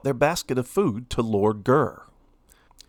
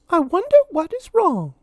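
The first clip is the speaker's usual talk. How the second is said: high, louder mouse voice pleading; Minnie pleading; 3 dB louder than usual. A narrator's reading. Minnie begging Lord Gurr, high pleading mouse voice. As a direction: beg sweetly for food.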